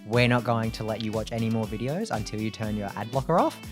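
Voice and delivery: normal talking voice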